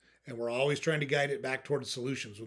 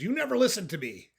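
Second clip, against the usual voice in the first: mildly hammed-up marital complaint, higher pitched; accusing spouse; 8 semitones higher, 4 dB louder than usual. Spouse starting a marriage fight, accusing. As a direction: complain like a fed-up spouse in a mock quarrel. Voices an angry spouse in example.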